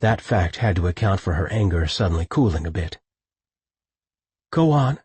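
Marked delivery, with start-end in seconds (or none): 4.5-5.0 a clipped, tight "Go on"; tightly. A narrator's reading. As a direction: hold back the anger. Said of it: "she told him tightly".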